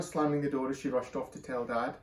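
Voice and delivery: usual speaking voice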